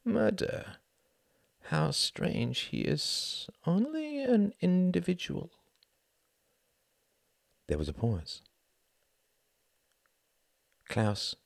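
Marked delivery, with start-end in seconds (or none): 0.0-5.5 slow, high, wondering voice; musing to himself. A narrator's reading. Klaus reflects on murder with eyes closed, musing. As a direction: muse with a half smile. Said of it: "half smiled to himself".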